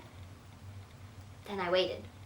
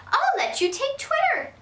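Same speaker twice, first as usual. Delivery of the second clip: higher, slow dawning delight; delighted grandma; 4 dB louder than usual. Acted. Grandma spotting the rule about birds, slow and delighted. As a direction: let the idea dawn slowly and happily.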